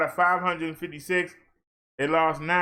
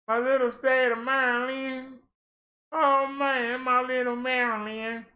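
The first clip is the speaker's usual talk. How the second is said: fond of Maryland; 6 semitones higher than usual. Real, not acted. Fond aside about home state.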